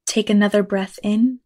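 ordinary talk, nothing marked

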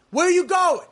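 high, loud demand from the cop; cop's sharp challenge. Retold or imitated. Cop stopping him mid-walk, high and loud challenge. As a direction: snap the question at him like a suspicious cop.

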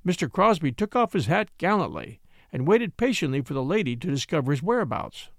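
ordinary delivery, nothing marked

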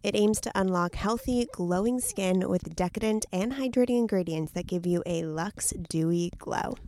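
upbeat sponsor ad read. Acted. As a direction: sell it like a radio ad.

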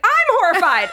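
high, emphatic retold outburst; horrified nurse. Nurse answering mortified patient, horrified.

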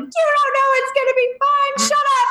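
very high, cracking mock outburst; retold outburst. Wife snapping at reassuring husband, very high teary outburst. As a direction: snap back at him in a high teary pitch. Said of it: "I lost it".

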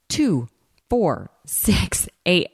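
imitating a dancer counting out beats; dancer's count. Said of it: "she starts counting".

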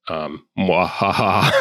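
slow evil mwahaha; sneering panto villain. Pretend. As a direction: cackle like a hammed-up villain. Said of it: "Good job".

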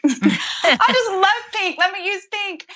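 high-pitched, drawn-out begging through a laugh; playful pleading. Retold or imitated. Mom begging the Lord to let her use pink, playful high-pitched pleading. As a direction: plead sweetly, way up high, stretching the words. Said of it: "I just closed my eyes and said".

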